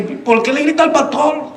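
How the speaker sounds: high-pitched put-on reproach; imitated scolding wife. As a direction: play a wife telling off her husband.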